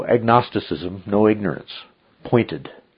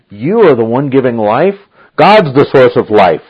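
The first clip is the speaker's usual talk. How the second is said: quoted words proclaimed loud and higher; potent declaration; 4 semitones higher, 9 dB louder than usual. Retold or imitated. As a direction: proclaim it with force, voice raised. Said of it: "What he said was so potent".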